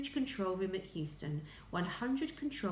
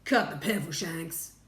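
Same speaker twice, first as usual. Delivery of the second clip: loud, curt rebuke; snapped; 7 dB louder than usual. A narrator's reading. President cutting short the astronaut, snapped. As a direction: snap it out with authority. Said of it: "snapped the President".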